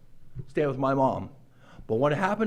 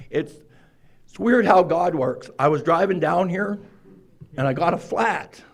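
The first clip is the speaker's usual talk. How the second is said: usual speaking voice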